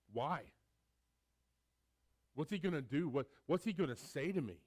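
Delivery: panicked questions, loud and high; the son frightened as his father runs at him; mock alarm of a guilty son; scared son.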